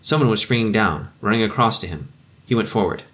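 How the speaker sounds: usual speaking voice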